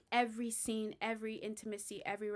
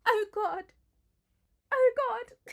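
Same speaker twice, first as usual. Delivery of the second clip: much higher, louder hammed-up moaning; mock moaning Penelope; 12 semitones higher, 10 dB louder, slower than usual. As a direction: overplay Penelope's moans for laughs. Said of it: "And then Penelope's like".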